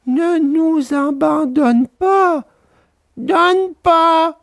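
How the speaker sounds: high, slow pleading whine; the fox pleads with Pinocchio, slow whine; whining fox. A narrator's reading. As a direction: beg as if starving.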